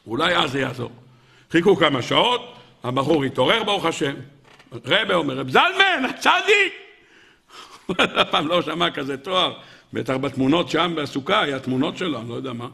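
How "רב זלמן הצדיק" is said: high, ringing call. Acted. Rebbe's envoys hail the waking drunkard as a tzaddik, calling out. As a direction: hail him grandly in a raised pitch. Calling the man awake by title.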